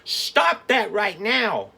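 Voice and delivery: slow, high, loud scolding shout; angry mother shouting. Acted. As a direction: bark it at the kids from the stairs. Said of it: "charged down the back stairs shouting".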